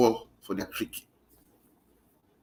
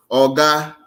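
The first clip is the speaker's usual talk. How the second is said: loud call; 4 semitones higher, 9 dB louder than usual. Real, not acted. Calling out to a politician.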